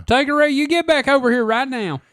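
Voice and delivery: high, loud hillbilly mom calling; hillbilly accent. Acted. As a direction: yell the kid home, hillbilly twang. Calling the child Tanqueray home.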